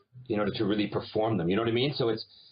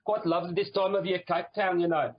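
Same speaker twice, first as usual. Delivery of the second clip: put-on higher-pitched South African accent; 7 semitones higher than usual. Actor retelling a lunch about Cape Town, South African accent. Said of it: "I started speaking with a South African".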